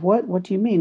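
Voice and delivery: mock baffled dad reply; retold puzzlement. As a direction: act out mild confusion, pitch lifted. Acts out his puzzled reaction.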